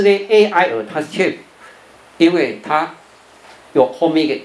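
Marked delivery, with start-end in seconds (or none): none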